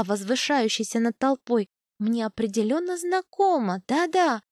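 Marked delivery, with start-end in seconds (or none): none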